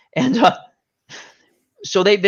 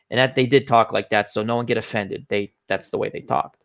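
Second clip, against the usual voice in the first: ordinary talk, nothing marked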